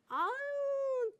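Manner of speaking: hammed-up friendly greeting hiding a dig; fake compliment. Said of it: "이런 식으로 나가는 거죠". (호들갑스럽게 칭찬하는 척 비꼬며)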